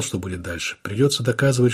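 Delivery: ordinary delivery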